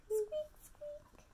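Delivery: very high, soft squeaks; mouse squeak. Acted.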